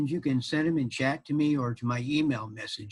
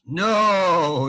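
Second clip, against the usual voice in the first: loud, drawn-out no; emphatic refusal; 5 semitones higher, 8 dB louder than usual. Acted. Peter refuses his wife's plea, loud stubborn refusal. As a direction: refuse it outright, higher and louder.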